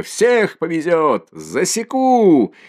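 slow, high, loud boast turning to a threat; drunken threat. Acted. Mikolka threatening the mare, a slow loud shout.

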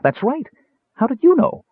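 very high, excited kender; high-pitched excited character voice. A narrator's reading. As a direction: squeak in amazed agreement. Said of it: "Tass said excitedly".

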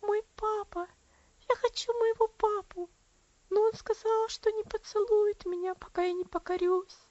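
put-on high, mournful little girl's voice; a lonely child longing for her father; plaintive child. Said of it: "Голосок был тихий и заунывный".

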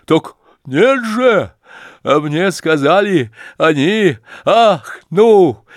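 high, loud, drawn-out exclamations; frenzied joy. A narrator's reading. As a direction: cry out as if beside yourself with joy. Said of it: "приходя чуть не в исступление от радости". (исступлённая радость, громко и высоко)

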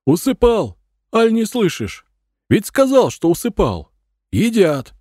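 put-on high, loud, testy old man; old man's voice. A narrator's reading. Old man at the door shouting about the feed, high testy voice. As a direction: call out impatiently in an old man's voice. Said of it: "старческий голос сказал".

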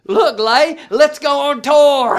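playful mock fan excitement; hammed-up teasing excitement. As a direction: tease the kid with a hammed-up, drawn-out fake thrill. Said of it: "I was like".

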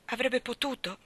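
normal talking voice

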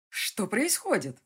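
loud, high, slow bark; alarmed bark. A narrator's reading. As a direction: bark the question in shock. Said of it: "рявкнул он".

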